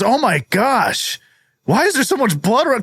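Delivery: walk in aghast at the scene; shocked Ben. Acted. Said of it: "Ben comes in and he says".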